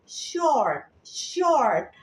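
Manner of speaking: "short" with a clear SH at the start; pronunciation model. Shown as a demonstration. Teacher shows students how it is read, "short" with clear SH. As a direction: model "short" slowly for learners, SH first. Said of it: "Ya, bacanya gitu ya".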